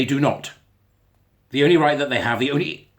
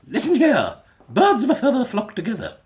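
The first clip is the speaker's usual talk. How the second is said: a much higher, put-on posh old lady; posh great-grandmother; 11 semitones higher, 4 dB louder than usual. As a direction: quote granny like a grand dame. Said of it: "I don't know why she sounded posh then".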